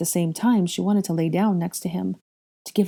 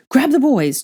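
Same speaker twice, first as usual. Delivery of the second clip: high, loud order; urgent shouted order; 8 semitones higher, 8 dB louder than usual. A narrator's reading. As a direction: bark the command. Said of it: "Charlie shouted".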